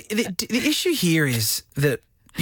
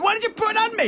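much higher, panicky mock squeal; mock freaked out; faster than usual. Host imitating a panicked woman at the courthouse, high mock squeal. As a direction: play the startled woman in the courthouse. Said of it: "them freaking out, going".

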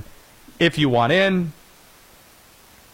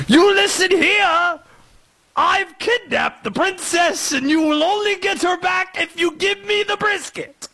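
villain's henchman. Acted. Host as evil henchman demanding brisket for the princess, villainous European accent. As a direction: ham up a cartoon villain's ransom demand. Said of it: "It's like the henchman of the villain".

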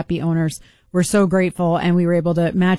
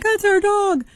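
excited; 11 semitones higher, slower than usual. Retold or imitated. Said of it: "they're like".

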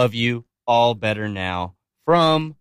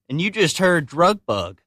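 ordinary delivery

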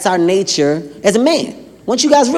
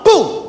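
door slam boom; 9 semitones higher than usual. Retold or imitated.

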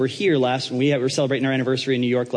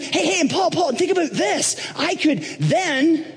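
excited Trump; slower than usual. Acted. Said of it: "And then he's like".

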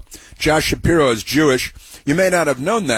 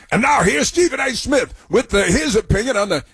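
mock announcer; 11 semitones higher than usual. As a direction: shout the intro like a sarcastic game-show host. Hyped-up sarcastic introduction of a clip.

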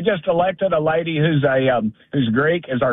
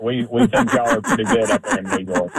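laughing; 10 semitones higher than usual. Real, not acted. Teasing hosts about funny accent.

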